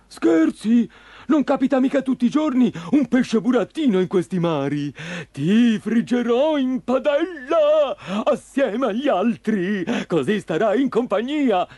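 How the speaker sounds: high, exclaiming monster voice with stretched words; gleeful menacing fisherman. Acted. Green fisherman promising to fry the puppet, gleefully menacing. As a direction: announce the frying with relish.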